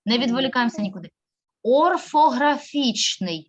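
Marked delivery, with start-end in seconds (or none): 1.6-3.5 Орфографічний drawn out slowly, every syllable clear; slow syllable-by-syllable model; slow, careful model word. Shown as a demonstration. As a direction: spell it out slowly for the class to repeat. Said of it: "слухаємо уважно мене".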